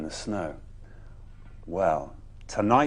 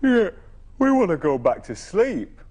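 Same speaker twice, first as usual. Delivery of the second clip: a hint of grumbly woken animals, higher and louder; grumbling animals; 9 semitones higher, 7 dB louder than usual. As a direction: grumble like grumpy sleepy creatures. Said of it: "they grumbled".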